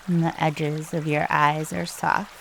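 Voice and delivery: soft, hushed meditation guide with long pauses; calm, unhurried breathing instructions, spoken softly; guided meditation. Real, not acted.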